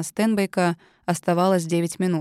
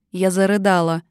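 usual speaking voice

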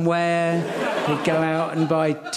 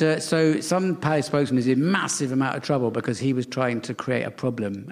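usual speaking voice

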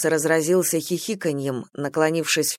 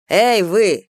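raised-voice shout at someone; calling out; 3 semitones higher, 6 dB louder than usual. A narrator's reading. Prisoner hailing a guard, shouted. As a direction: call loudly to get their attention. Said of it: "закричал Бадзы стражнику".

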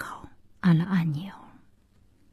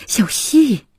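name called out higher and louder; raised, pleading call; desperate call; 6 semitones higher, 5 dB louder than usual. Acted. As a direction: cry out to stop someone leaving. Pleading call during a breakup.

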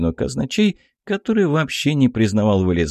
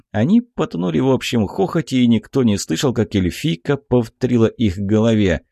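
ordinary delivery, nothing marked

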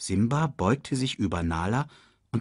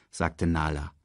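ordinary delivery

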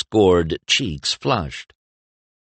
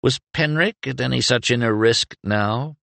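plain reading voice

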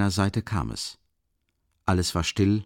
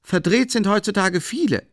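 much higher, loud tart reply; knowing woman; 13 semitones higher, 5 dB louder than usual. A narrator's reading. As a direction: sigh at the times. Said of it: "meinte die Frau".

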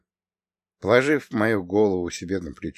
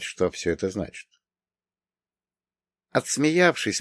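usual speaking voice

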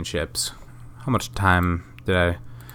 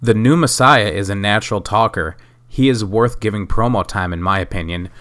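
ordinary delivery

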